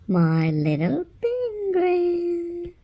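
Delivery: high slow sing-song; high coo. Acted. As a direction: coo lovingly to the baby. Cooing to baby.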